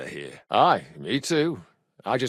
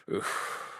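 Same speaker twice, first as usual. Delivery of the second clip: soft unvoiced exhale of effort; tired breath out; weary breathy oof; 10 dB quieter than usual. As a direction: let the body drop with a puff. Sitting down exhausted.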